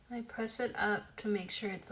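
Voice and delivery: ordinary talk, nothing marked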